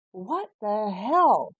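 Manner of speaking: doctor storming in, mock exasperated; retold exasperation. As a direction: drag it out in disbelief. Doctor's outburst.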